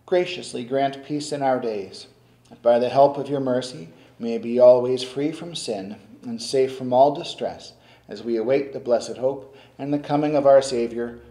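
ordinary delivery

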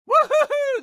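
high excited announcer whoop. Acted. Announcer whooping as cars hit bombs, high and excited. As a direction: cheer the big stunt with a high whoop.

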